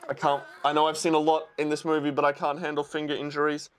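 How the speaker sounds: normal talking voice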